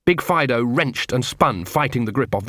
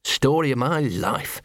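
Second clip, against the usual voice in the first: self-pitying sigh; 2 semitones higher, slower than usual. Acted. Gaspode mutters his old line, wry self-pity. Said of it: "he shouted".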